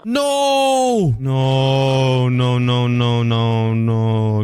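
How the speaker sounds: real cry of despair fading down. Real, not acted. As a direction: wail it as everything goes wrong. Pet stuck, yelling in despair.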